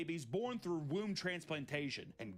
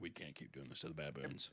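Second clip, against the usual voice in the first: much lower and softer, fed-up mumble; mock weary doctors; 13 semitones lower, 7 dB quieter than usual. Imagined doctors giving up on baboons, low and soft. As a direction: sigh it out like tired surgeons.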